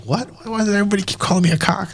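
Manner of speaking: high-pitched, rapid baffled protest; mock bewilderment. Visitor retelling being called a cock, high mock bewilderment. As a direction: rush it out high, playing utterly confused.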